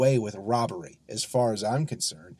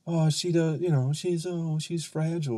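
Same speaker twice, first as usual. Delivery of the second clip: higher mocking voice of her defenders; mock protective; 4 semitones higher than usual. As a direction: imitate someone fussing over a delicate lady. Mocking those who protect the woman.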